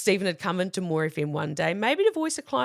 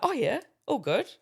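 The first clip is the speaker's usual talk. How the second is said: breezy, offhand yeah; acting casual; 6 semitones higher than usual. Receptionist playing it cool when asked onto air, breezy casual. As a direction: play it cool while secretly thrilled. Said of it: "Acted real casual".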